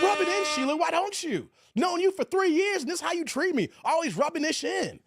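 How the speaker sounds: high-pitched playful grievance; mock indignation. Streamer to bragging viewer Sheila, mock wounded complaint.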